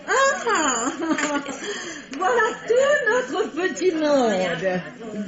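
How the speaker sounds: high, slow gush through laughter; laughing welcome. Real, not acted. Actress greets the ladies arriving late, laughing and gushing. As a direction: greet them with a trilling laugh.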